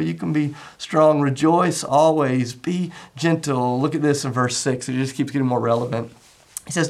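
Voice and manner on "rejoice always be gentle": very slow, each word set apart; slow emphasis. Shown as a demonstration. Pastor summing up Paul's commands, slow and deliberate. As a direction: stretch Rejoice always, be gentle out slowly.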